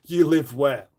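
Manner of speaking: hammed-up menace on the question; mock threatening. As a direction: lean in like a threat. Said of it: "That sounds almost threatening".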